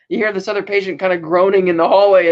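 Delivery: ordinary talk, nothing marked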